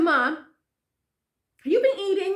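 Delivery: high, loud, drawn-out question; re-enacted insistent daughter; pressing mom.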